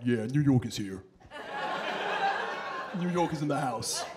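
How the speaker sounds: low, self-satisfied New Yorker swagger; smug inner voice. Acted. Arrogant New Yorker walking in another city, smug inner voice. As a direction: think it smugly, pitched low. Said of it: "I don't say this out loud".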